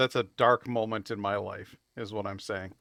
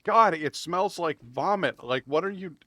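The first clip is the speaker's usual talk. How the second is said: disgusted rant; 5 semitones higher, 4 dB louder than usual. Retold or imitated. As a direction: recoil from the stink as you say it. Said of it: "And I'm like".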